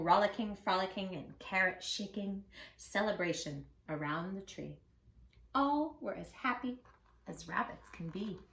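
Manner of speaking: ordinary delivery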